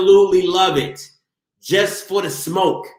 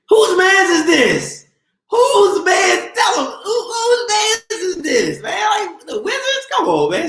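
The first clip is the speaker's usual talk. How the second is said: mocking yell; 12 semitones higher than usual. Real, not acted. Streamer roasting a chatter's team, high mocking shout. Mocking a Wizards fan in chat.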